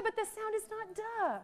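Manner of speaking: "duh" held up high with a full uh vowel; wrong added schwa. Shown as a demonstration. Trainer correcting teachers, stressing the wrong "duh" with its extra vowel. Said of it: "not schwa it".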